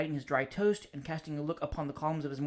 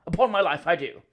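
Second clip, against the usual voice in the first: hearty greeting, much louder; top of his voice; 8 dB louder than usual. Acted. Cousin bursts in on the bachelor, booming at full voice. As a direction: boom the greeting across the room. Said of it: "He always spoke at the top of his voice".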